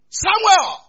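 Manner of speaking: loud, high, drawn-out call. Acted. God calls the boy Samuel a second time, calling out. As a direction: call the name as if summoning someone afar.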